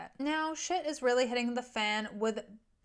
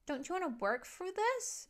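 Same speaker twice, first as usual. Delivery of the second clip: higher, softer put-on Janine voice; a soft, higher plea for him to stay; voiced Janine; 7 semitones higher, 4 dB quieter than usual. Janine pleading in retold scene.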